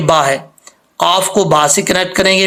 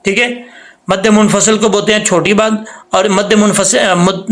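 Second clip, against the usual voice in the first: ordinary talk, nothing marked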